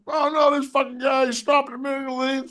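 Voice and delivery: high-pitched imitation of a ranting driver; mock hollering. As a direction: mimic your buddy griping at traffic. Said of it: "he's screaming and hollering at everybody".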